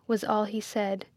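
ordinary delivery, nothing marked

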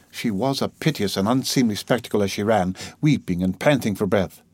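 usual speaking voice

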